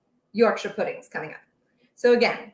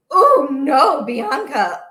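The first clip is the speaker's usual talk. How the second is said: dismayed oh no; 5 semitones higher, 9 dB louder, much slower than usual. Real, not acted. Reacting to viewer's bad news.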